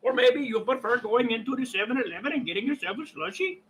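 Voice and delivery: quick-fire imitation Indian accent; put-on Indian accent, rattled off. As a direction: play a shop seller with an Indian accent. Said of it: "I do a very awesome Indian accent".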